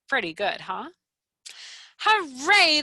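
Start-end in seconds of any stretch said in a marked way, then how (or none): 2.0-2.8 high, loud hooray; cheer. Acted. The class cheering Froggy's platform, high loud hooray. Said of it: "the class cheered".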